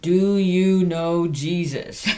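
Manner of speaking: slow, deliberate "Do you know Jesus?"; nice and slow. Retold or imitated. Asking the caller again, nice and slow. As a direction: drag every word out so she understands. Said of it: "Nice and slow".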